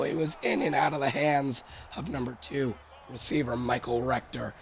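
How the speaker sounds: ordinary delivery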